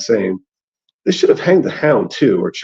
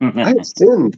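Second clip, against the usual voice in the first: raised, pleading septon wail; mock wail; 6 semitones higher, much slower than usual. A narrator's reading. Septon wailing to Lord Beric, a light mock wail. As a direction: wail it like a guilty holy man. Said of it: "the Septon wailed".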